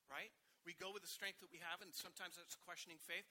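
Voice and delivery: normal talking voice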